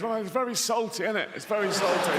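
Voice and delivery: high-pitched comic goldfish voice; goldfish in seawater. Acted. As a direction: play a goldfish discovering the sea. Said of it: "gets in the sea and he goes".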